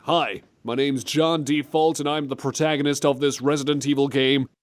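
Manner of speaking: mock American game protagonist; hammed-up American accent, generic leading man. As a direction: do a bland default American hero. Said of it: "give him a John Default American generic voice".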